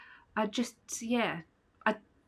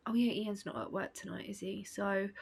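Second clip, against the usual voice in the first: normal talking voice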